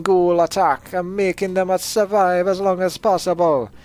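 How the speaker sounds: put-on high, loud, drawn-out Jamaican accent. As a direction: read it out slowly in a big, high-pitched Jamaican accent. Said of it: "I decided to read in a Jamaican accent".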